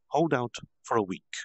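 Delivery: usual speaking voice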